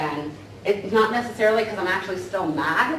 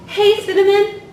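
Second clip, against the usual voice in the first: higher, louder bright hello across the room; cheery greeting; 13 semitones higher, 9 dB louder than usual. Retold or imitated. Walking in and greeting a coworker, loud cheery hello. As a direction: call out a big friendly hello. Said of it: "I was expecting".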